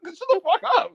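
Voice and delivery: high mock-furious outburst; imitated yelling. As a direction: cut him off with a high mock yell. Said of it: "Why are you yelling at me".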